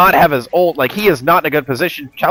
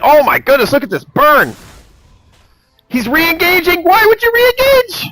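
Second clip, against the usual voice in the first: much higher, astonished yelling; excited shout; 3 dB louder than usual. Real, not acted. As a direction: shout in disbelief at the play. Caster reacting to a big fight.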